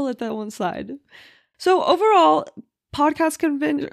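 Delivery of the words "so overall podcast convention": big bright kickoff, loud and high; excited. Real, not acted. Host kicking off her podcast recap, excited. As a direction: announce the topic with a burst of energy.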